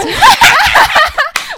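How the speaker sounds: high loud laugh; shrieking laugh. Real, not acted.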